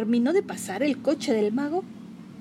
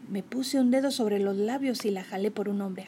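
ordinary delivery

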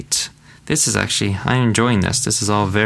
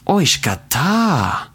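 Oishikatta said higher, with relish; delighted; 6 semitones higher, slower than usual. Shown as a demonstration. Teacher answering how the meal was, delighted. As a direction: savor Oishikatta like a great meal. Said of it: "How was the meal?".

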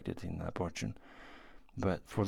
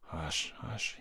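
soft, breathy whisper, softer; whispered hush; 3 semitones lower, 8 dB quieter than usual. A narrator's reading. Gurov soothing tearful Anna, whispering. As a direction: hush her gently in a whisper. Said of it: "he muttered".

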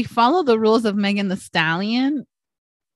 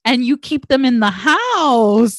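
slow, louder and higher mock outrage; mock accusing; 5 semitones higher, 5 dB louder, slower than usual. To Lucas's mom about the prom photos, slow mock outrage. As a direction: accuse her with a slow dramatic beat. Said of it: "I looked at his mom and I was like".

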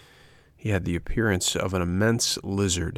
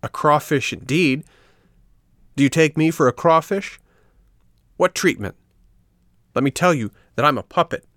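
higher, louder indignant puppet; angry voice; 7 semitones higher, 4 dB louder than usual. A narrator's reading. Puppet Pinocchio furious at being called a crawfish, shouting high. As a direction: protest hotly at the insult. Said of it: "said in an angry voice".

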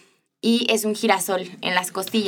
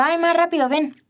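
urgent call; 9 semitones higher than usual. Retold or imitated. Calling mom urgently.

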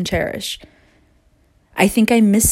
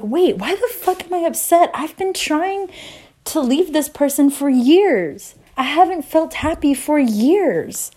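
sudden realization; 7 semitones higher than usual. Retold or imitated. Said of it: "And I was like".

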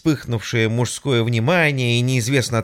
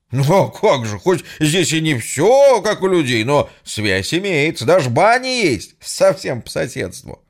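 higher, loud chatty yell; loud cheerful shout; 5 semitones higher, 3 dB louder than usual. Acted. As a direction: shout the news down the line. Hero shouting over phone. (громко в трубку)

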